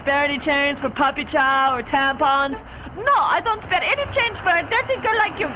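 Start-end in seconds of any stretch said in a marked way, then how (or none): 2.9-5.5 mocking prissy lady. Mocking the lady's refusal.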